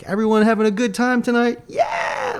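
high, shouted crowd hype. Retold or imitated. To the crowd at the half-empty show, a high hype shout. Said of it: "I was like".

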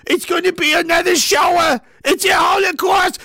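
mock panic. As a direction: ham up the alarmist pundit. Mocking CBC alarmism.

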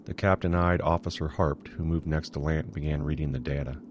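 ordinary delivery

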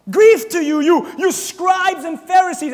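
slow, loud shouting rebuke; Jesus screaming at the scribes. Acted. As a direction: scream it at the Pharisees. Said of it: "He's screaming it to them".

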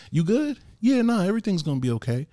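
high, quick breezy deflection; imitating a casual "I'm okay"; mock brush-off. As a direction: rattle it off like nothing's wrong. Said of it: "be like".

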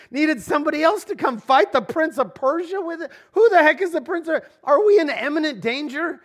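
high, loud, racing panic; breathless alarm at the Prince of Persia; alarmed questions. Acted. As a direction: fire off the questions like a frightened man. Preacher voicing Daniel's alarmed questions.

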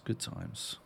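soft whisper. Real, not acted. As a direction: trail off in a whisper. Closing, whispered.

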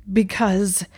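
gasped out under strain. A narrator's reading. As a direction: force the word out on a gasp. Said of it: "Malfoy gasped".

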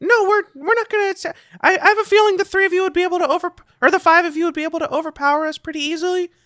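high, fast goblin voice; hurried, high-pitched goblin reassurance; young goblin. Acted. Goblin assures strangers they won't attack, high and fast. Game master plays the goblin.

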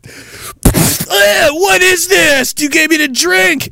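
high, slow, disgusted whine; high whiny captive voice. Acted. Captive whining to the guards about the water, high and slow.

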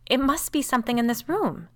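high, loud, panicky; wild insistence. A narrator's reading. Frightened sister seeks a cause in the room, wildly. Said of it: "staring wildly around".

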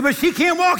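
high, loud pleading objection; loud protest. Retold or imitated. The ladies to Wigglesworth, a loud alarmed protest. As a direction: argue back, alarmed.

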